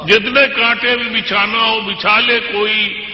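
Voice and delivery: measured, declamatory poetry recital; stately nazm recitation. Acted. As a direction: recite each couplet with weight.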